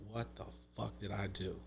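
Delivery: hushed, crushed self-question; despairing mutter. Retold or imitated. Sitting on the front steps after the flooded basement, a defeated near-whisper. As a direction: breathe it out, beaten.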